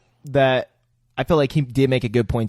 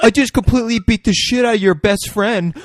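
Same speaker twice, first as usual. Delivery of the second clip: higher, louder hammed-up tough guy; mock Wahlberg voice; 9 semitones higher, 7 dB louder than usual. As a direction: ham up the bruised tough guy. Friends riffing character voice.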